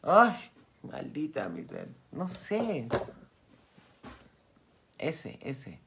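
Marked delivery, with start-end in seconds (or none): 0.0-0.3 mock shriek. Self-mocking outburst.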